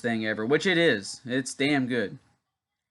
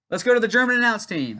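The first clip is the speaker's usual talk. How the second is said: much higher, louder hammed-up TV host voice; mock announcer; 10 semitones higher, 6 dB louder than usual. Mocking wrestling show handoff to commentators, hammed-up announcer. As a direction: toss it over like a cheesy broadcaster.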